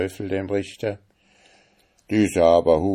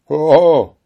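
loud, high scornful "Hoho"; angry outburst; 9 semitones higher, 11 dB louder than usual. Acted. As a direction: bark it out at the stranger. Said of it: "fuhr der Richter heraus". (lauter, höhnischer Ausruf)

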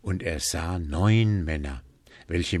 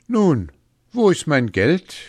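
loud, raised-pitch demand; 9 semitones higher, 9 dB louder than usual. A narrator's reading. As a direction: call in a debt, high and loud. Hans demands his winnings.

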